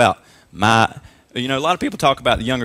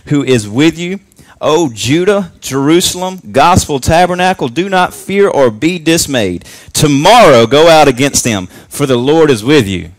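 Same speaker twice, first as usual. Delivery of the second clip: loud, higher proclamation of the verse; preaching at full force; 3 semitones higher, 7 dB louder than usual. Acted. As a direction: thunder the promise out to the church. Reading promise to congregation loudly.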